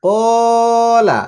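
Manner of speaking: very slow, long-held blend; drawn-out joined word. Shown as a demonstration. Teacher showing pupils how syllables join, very slow. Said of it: "যুক্ত করে করে উচ্চারণ".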